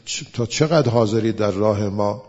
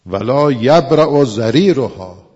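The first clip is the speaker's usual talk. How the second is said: slow, loud chanted Arabic; sermon chant of a holy text; scripture recitation; 2 semitones higher, 10 dB louder, slower than usual. Acted. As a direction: intone the verse like a preacher.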